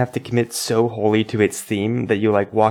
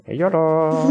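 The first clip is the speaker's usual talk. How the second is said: high, drawn-out game-character yolo; Theo; 9 semitones higher, 3 dB louder than usual. Retold or imitated. Player imitates how the game sounds out yolo, playful high impression. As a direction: mimic the game's voice, pitched up and stretched out. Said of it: "it actually".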